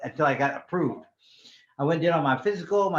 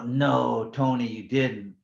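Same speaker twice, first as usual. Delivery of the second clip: slow, low retold disbelief; drawn-out disbelief; 4 semitones lower, much slower than usual. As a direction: stretch it out in lower-pitched disbelief. Family disbelieves his news.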